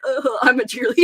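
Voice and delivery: lightly voiced dopey cheerleader; a hint of a goofy cheerleader, tossed off; terrible impression. A host imitates a friend's cheerleader bit, lightly goofy. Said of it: "that was a terrible impression".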